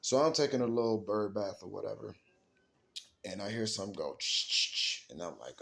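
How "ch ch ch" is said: imitated rustling. Imitating a noise heard in the woods.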